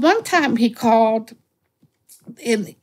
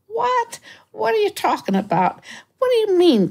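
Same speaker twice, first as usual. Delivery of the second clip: put-on baffled old man; 13 semitones higher than usual. Acting old caller's baffled protest.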